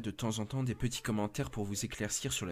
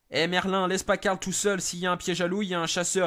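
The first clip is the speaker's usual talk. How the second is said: higher, louder urgent warning; urgent warning call; 8 semitones higher, 6 dB louder than usual. Acted. The radio operator warns Merlin over the radio to stay, loud and urgent. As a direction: call out to stop him leaving.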